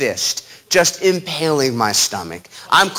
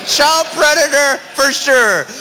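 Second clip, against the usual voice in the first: panicked thought; 10 semitones higher, 3 dB louder, slower than usual. Retold or imitated.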